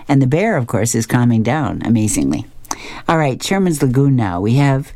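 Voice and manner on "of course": ordinary delivery, nothing marked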